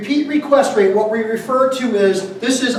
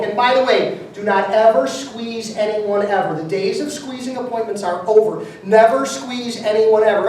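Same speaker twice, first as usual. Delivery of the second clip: ordinary talk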